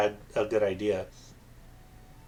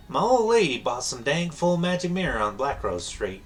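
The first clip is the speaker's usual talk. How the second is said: higher, folksy southern villager twang; southern accent; 5 semitones higher, 3 dB louder than usual. Acted. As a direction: play a gossiping southern townsman. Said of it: "I can do a southern accent".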